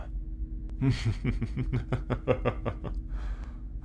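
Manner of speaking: airy amused laugh trailing off; mocking chuckle. Captor calling captive a collector's item, mocking chuckle. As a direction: laugh softly at your own joke.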